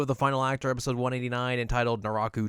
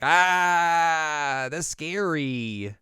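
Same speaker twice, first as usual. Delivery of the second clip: high yelp then slow "that's scary"; mock fright; 6 semitones higher, 4 dB louder, much slower than usual. Rin seeing Magatsuki, mock frightened. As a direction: play it scared, half-joking. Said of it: "she's like".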